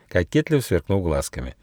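ordinary delivery, nothing marked

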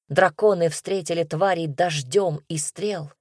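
usual speaking voice

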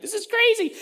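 high-pitched giddy amazement; amazed onlookers. Acted. Israelites hurrying through the sea, high amazed voice. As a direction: marvel in a high, excited voice. Israelites marvelling while crossing.

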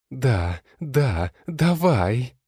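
rising high squealing cheer; excited shriek. Acted. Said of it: "кричит с дерева лень".

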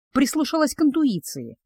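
ordinary delivery, nothing marked

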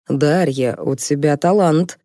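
ordinary delivery

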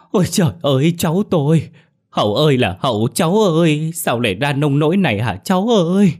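grandmother's high, loud lament; distraught wailing. Acted. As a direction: wail out for your lost grandchild, drawing out the calls. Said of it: "bà Đạm mặt mũi mếu máo".

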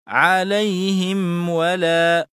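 عليهم ولا very slowly, m distinct before w; clear meem, unexaggerated. Shown as a demonstration. Teacher demonstrates for students, slow عليهم with clear, unexaggerated m. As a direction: draw out عليهم and release a clean m into ولا. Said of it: "فيتم إظهارها بالطبع من دون مبالغة".

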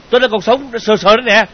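loud, high, indignant shout; furious, full-volume scolding; angry yelling. Acted. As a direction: yell it in outraged protest. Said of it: "Anh ta đẩy tay A Tử ra quát tháo".

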